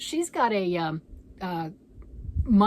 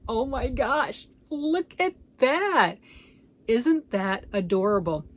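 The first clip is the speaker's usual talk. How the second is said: delighted; 4 semitones higher, 5 dB louder, slower than usual. Real, not acted. Sweater fits the Grinch.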